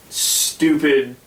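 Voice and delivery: normal talking voice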